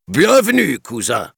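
high, loud mocking welcome; sneering monkey king. Monkey king welcoming Mowgli, high mocking sneer. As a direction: greet him with a sly, showy sneer. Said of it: "ricana le roi".

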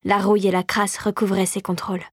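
usual speaking voice

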